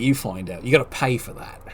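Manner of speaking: coy hush. Real, not acted. Commentator refusing listeners his pick, coy hush. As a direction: let it fall away low and quiet.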